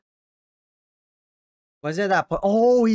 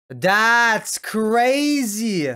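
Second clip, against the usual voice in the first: stunned drawn out; 7 semitones higher, 5 dB louder, much slower than usual. Real, not acted. Stunned reaction.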